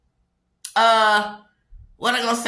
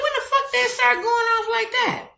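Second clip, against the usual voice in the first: much higher, startled voice asking when they went off; shocked disbelief; 12 semitones higher than usual. Real, not acted. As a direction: gasp it out in disbelief. Shocked reaction to the fight.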